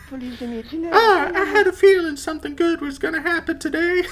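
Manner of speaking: put-on very high, sweet old granny; grandma voice. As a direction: squeak it out like a doting grandma. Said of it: "grandma voice".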